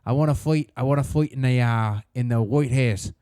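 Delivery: rough put-on Irish accent; Conor McGregor. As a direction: imitate an Irish fighter announcing a comeback. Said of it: "Conor McGregor".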